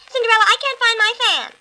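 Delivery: fast, high whiny complaint; bossy stepsister. A narrator's reading. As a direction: whine it quickly, high and loud. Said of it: "looking at themselves in the mirror".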